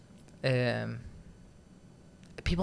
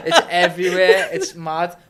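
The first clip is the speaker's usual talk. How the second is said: louder, higher, words through laughter; laughing; 5 semitones higher, 9 dB louder, slower than usual. Real, not acted. Still laughing at the host's joke, laughing.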